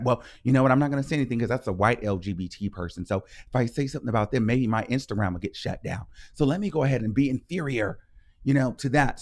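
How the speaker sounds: a hint of a cowed onlooker; timid inner voice. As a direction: lightly voice a scared follower. Voicing timid people's inner thoughts.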